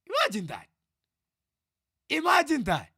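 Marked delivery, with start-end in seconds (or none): 2.1-2.9 louder, higher exclamation; exasperated, higher and louder; incredulous emphasis. Real, not acted. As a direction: drive it home, raised and loud.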